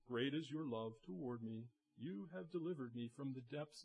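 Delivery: soft and lifeless, voice dropping away; flat, quiet reading. Shown as a demonstration. Pastor showing how David did not pray, low and flat. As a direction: read it as if it meant nothing. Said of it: "Oh, he doesn't say".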